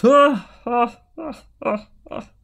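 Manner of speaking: high, loud ha ha ha, then slow scorn; king laughing at the small knight; loud, high mocking king laughter. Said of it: "The king laughed".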